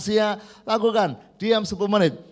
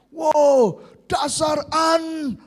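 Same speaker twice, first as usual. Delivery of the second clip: mock cursing shout; 6 semitones higher, much slower than usual. Pastor retelling his road rage, mock angry shout. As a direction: bark the insult like an angry driver. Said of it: "dia langsung maki-maki".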